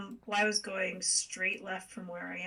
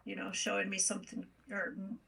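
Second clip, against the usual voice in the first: ordinary delivery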